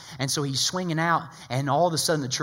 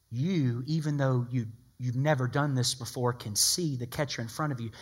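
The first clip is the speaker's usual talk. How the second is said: ordinary delivery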